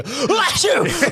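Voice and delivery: comic high-pitched "achoo"; Qui-Gon sneezing. Acted. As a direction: burst out a big fake sneeze. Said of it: "I hope he sneezes through the Force".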